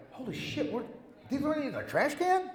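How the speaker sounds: high startled disbelief, imitating a friend; quoting a stunned friend, high and surprised. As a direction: pitch it way up in amazed surprise. Luke surprised by pictures in trash.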